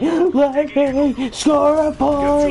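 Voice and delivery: high, slow whiny mock sob; mock crying whine. Bratty kid begging to score, mock crying whine. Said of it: "he starts crying".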